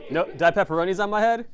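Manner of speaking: laughing through the question; amused, giggling words. Real, not acted. Laughing at pizza topping mishap.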